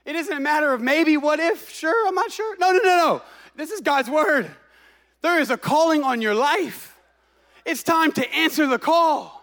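fervent full-force preacher; preaching. Acted. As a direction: preach it with rising fire. Pastor preaching with rising force.

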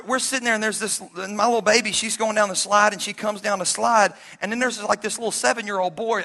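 ordinary talk, nothing marked

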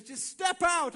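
loud, high push to act; urgent encouragement. Acted. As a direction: call them forward forcefully. Jesus urges believer to act.

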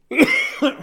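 sharp loud cough. Real, not acted. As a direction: clear the throat with a cough. Coughing fit mid podcast.

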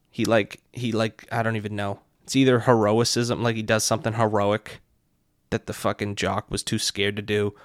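ordinary delivery

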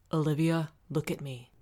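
firm, controlled command; steady them with a firm order; hard and urgent. A narrator's reading. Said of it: "his voice hard and urgent".

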